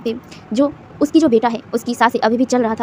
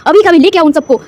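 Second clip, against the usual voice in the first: loud, higher furious yell down the phone; angry shout; 4 semitones higher, 6 dB louder than usual. A narrator's reading. Father yelling at helpers on the phone, furious. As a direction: bellow at them to hurry. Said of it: "वो चिल्लाने लग गया था".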